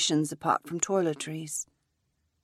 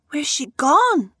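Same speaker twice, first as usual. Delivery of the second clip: alarmed question; 9 semitones higher, 7 dB louder, slower than usual. Acted. Sue asks where woman went.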